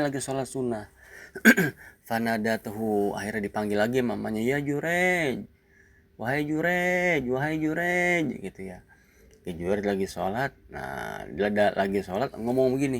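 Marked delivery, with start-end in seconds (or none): 4.4-8.5 high, slow, drawn-out calls; mother calling out. Acted. Mother returning next day, calling out to her praying son. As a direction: call the name high, holding the last syllable.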